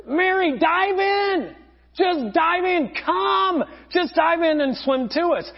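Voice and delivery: yelling. Retold or imitated. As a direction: shout urgently to someone on the dock. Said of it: "everybody is on the side of the boat yelling".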